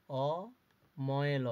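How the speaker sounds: slow spelling out. Shown as a demonstration. Teacher to pupils, drawing অময়ল out very slowly. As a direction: say অময়ল very slowly, each part drawn out. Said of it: "এরপরে".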